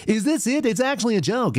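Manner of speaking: lightly put-on high announcer tone; a hint of the fake news voice; mock anchor voice. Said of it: "talks to you like this".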